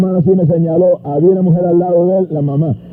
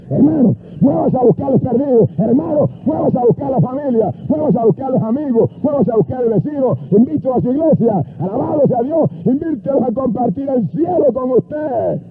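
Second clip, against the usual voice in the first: high, repetitive preaching cadence; fervent exhortation; 7 semitones higher, 3 dB quieter than usual. Real, not acted. Preacher urges church members to seek the lost, fervent. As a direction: drive each command home at a raised pitch. Exhorts congregation at full force.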